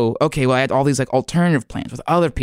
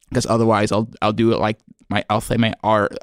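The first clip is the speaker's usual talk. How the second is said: normal talking voice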